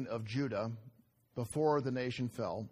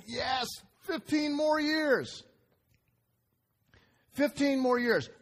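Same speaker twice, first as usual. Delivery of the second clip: much higher, slow gleeful cry; gleeful Hezekiah; 12 semitones higher, 3 dB louder, slower than usual. Retold or imitated. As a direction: crow it like a delighted king. Hezekiah rejoices at extension.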